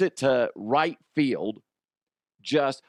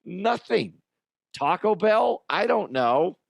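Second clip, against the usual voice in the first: baffled dad; 7 semitones higher than usual. Retold or imitated. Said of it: "I'm like".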